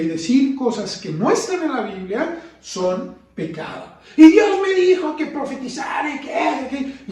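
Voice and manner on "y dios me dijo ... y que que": higher, louder mock proclamation; mock preacher claiming God spoke; mock false prophet. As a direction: shout it like a showy false prophet.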